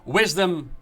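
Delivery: ordinary talk, nothing marked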